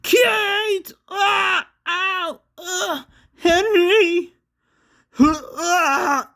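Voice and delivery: very high, drawn-out cries; screaming in pain. A narrator's reading. Henry reaching out to Kate, screaming in pain. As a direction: wail names between high yelps of agony. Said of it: "he screamed as he reached out".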